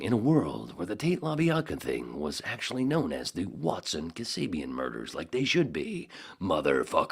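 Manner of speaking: loud, hammed-up trailer announcer; movie-trailer promo. Host improvising a promo about the murders, mock trailer voice. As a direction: build it like a trailer, roar the last word. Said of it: "Sorry, is that a promo?".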